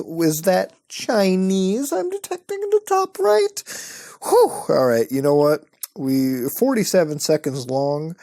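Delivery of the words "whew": a sudden high-pitched whew of shock; jolted by the noise, let out a high whew; startled high whew. Real, not acted. Reacting to a blaring video clip, a high startled whew.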